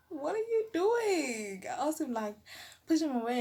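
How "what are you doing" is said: high, slow, stretched disbelief; slow shocked question; alarmed question. Retold or imitated. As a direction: draw out the alarm. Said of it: "I'm like".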